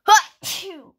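loud high achoo; pretend sneeze. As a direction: let out a big play sneeze. Pretend sneeze in toy play.

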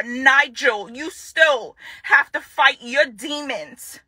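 ordinary talk

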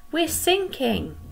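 high, slowed alarm; panicked cry. Acted. Teacher voicing a sailor in the storm, alarmed cry. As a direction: shout the danger up to the sky. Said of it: "cried a sailor".

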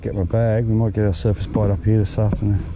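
ordinary delivery

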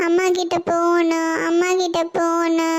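put-on slow, whiny little boy; a kid pleading to go to mom; whining child. Boy whines for mother.

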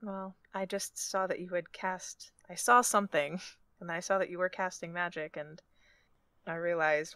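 soft, halting explanation; quiet, awkward kindness; hesitant compassionate character. Acted. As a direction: reach out gently, pausing to find words. Player voices her compassionate character.

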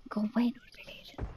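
breathy whisper, no voice. Real, not acted. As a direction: hush it at a pop-up. Hushed while sneaking in game.

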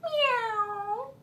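soft, very high cat meow; high-pitched, quiet kitty call. Shown as a demonstration. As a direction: mew like a small cat. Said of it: "That's a kitty cat".

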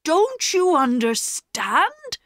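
high, slow, exasperated question; acted indignant appeal. A narrator's reading. Perks argues with the children.

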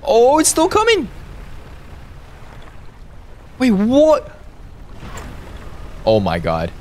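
frantic high shouting; scared horror game streamer. Real, not acted. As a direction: scream as it keeps chasing you. Serpent still chasing.

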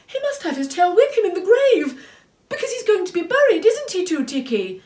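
high, loud pleading character; very upset. A narrator's reading. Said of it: "Moomintroll cried, very upset".

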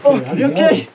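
high, loud worried check; anxious concern; panicking gamer. Real, not acted. Checking on friends after blast.

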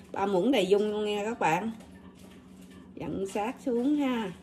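usual speaking voice

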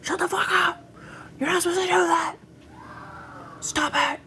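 very high, mock-parent telling-off; scolding parents. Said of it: "our mom and stepdad would be like".

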